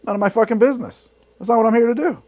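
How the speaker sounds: mock rant in Chris Bowden's voice; imitating Chris Bowden. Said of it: "as Chris Bowden said".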